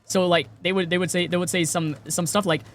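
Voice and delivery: ordinary talk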